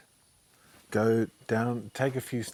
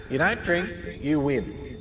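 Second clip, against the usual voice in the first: the voice comes back insistently; haunting refrain; echoing memory; 4 semitones higher, slower than usual. Retold or imitated. Memory voice repeats.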